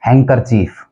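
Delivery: हैंकरचीफ as HANG-ker-cheef; हैंकरचीफ going from nasal straight to K; correct pronunciation. Shown as a demonstration. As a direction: drop the D in हैंकरचीफ. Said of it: "correct pronunciation है".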